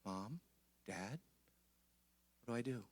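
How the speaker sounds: put-on soft, small voice of a lost child; a child quietly begging for help; child asking parent. Child asking mom and dad for help, softly. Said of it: "your child's looking at you and going".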